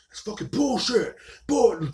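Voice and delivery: high mock yell from an angry customer; imitated angry yell. Retelling a customer yelling at him at the store door, high mock yell. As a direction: bark it like an irate shopper stuck outside. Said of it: "yelling at me".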